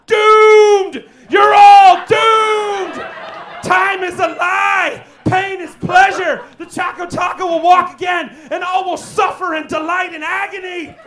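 loud, high, doomsday madman howl; slow ominous raspy drawl. Acted. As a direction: proclaim the apocalypse like a crazed prophet. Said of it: "slow ominous raspy drawl".

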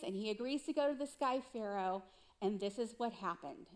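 ordinary delivery

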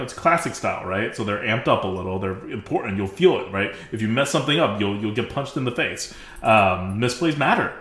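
ordinary talk

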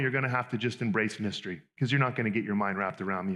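normal talking voice

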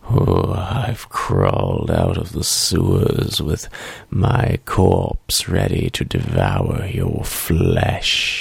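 low slow zombie growl. Acted. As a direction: rise from the sewer and growl slowly. Zombie corpse from the sewers.

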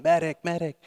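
distant call for medic. Retold or imitated. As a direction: yell for a medic from afar.

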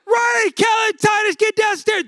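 high, loud panicked yell up the stairs; frantic shout. Retold or imitated. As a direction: bellow for the kids in a panic. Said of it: "I'm like".

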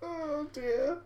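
ordinary talk

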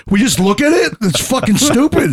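high-pitched disbelief slowing into disgust; mock incredulous complaint. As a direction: act out an unimpressed tourist. Acted complaint of disappointed spectator.

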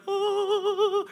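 high, wavering angelic "oh"; mock-heavenly high held note; awed choir note. Pastor shows listeners the perfect grass, high awed oooh.

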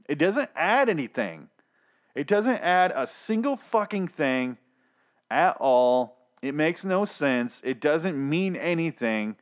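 high, slow and incredulous, dragging each word out; exasperated rant. Real, not acted. Reviewer ranting to viewers about pointless clown scenes, exasperated. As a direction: let the disbelief pile up line by line.

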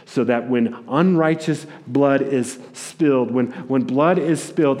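ordinary delivery

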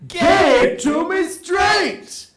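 high, slow announcer yell; hammed-up high title call; segment-title shout. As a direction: belt out the game-show title.

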